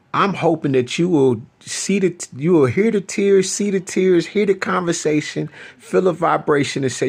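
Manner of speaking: high-pitched, teary pleading; tearful plea. Real, not acted. Speaking to his ex, tearful and pitched high. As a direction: voice rising as the tears come. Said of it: "I'm crying".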